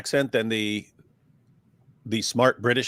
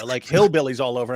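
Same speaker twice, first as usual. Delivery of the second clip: ordinary delivery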